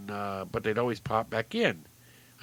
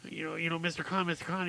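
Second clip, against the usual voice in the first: put-on higher, rushed, stammering kid; pleading little boy; 6 semitones higher, 6 dB quieter, faster than usual. Boy begs clown to show one trick, rushed and pleading. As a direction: tumble over the words in a timid plea.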